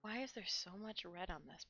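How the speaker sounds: unvoiced whispered confession; whispering. Real, not acted. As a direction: whisper it like a guilty secret. Confessing a painting mishap.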